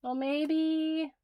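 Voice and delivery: normal talking voice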